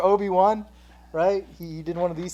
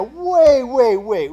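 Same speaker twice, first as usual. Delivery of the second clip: surprised; 8 semitones higher, 11 dB louder, much slower than usual. Real, not acted. Stopping the story.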